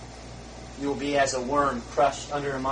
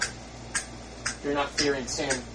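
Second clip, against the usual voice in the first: hushed warning; 8 dB quieter, much slower than usual. Real, not acted.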